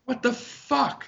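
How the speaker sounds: high-pitched quoted outrage at maskless people; retold indignant outburst. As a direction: fire it off in disbelief. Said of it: "I'm like".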